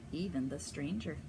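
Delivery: usual speaking voice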